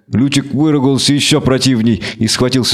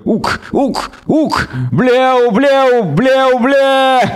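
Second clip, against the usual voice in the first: goat creature bleating; 12 semitones higher, much slower than usual. Acted. Creature keeps bleating at them.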